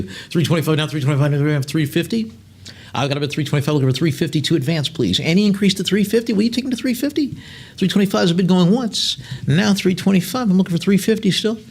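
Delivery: eager auction patter; auctioneer bid call. Acted. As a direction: egg the room on for the next bid. Auctioneer calling bids.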